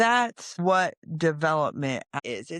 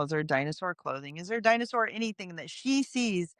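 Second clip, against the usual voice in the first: ordinary talk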